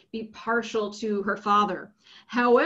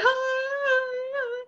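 a high, hammed-up wailing sob-laugh; mock sob. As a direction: fake a pitiful sob, tongue in cheek. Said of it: "She cries".

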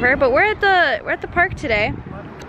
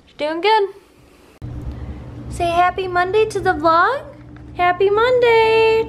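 puppy-talk voice; 7 semitones higher than usual. Real, not acted. Owner greeting the vlog for her puppy, high cooing. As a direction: coo the greeting up high for the pup.

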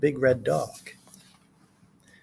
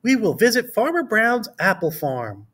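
higher-pitched storybook teacher, lightly voiced; a hint of a teacher's higher voice; teacher character; 6 semitones higher than usual. A narrator's reading. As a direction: lightly voice a teacher announcing an outing. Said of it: "said Miss Carrington".

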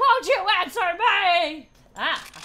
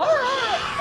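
high scream of fright; startled scream; 9 semitones higher, 3 dB louder than usual. Real, not acted. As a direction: scream from a sudden scare. Startled during horror game.

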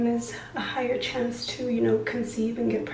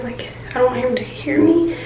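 ordinary talk, nothing marked

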